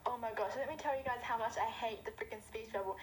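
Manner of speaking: usual speaking voice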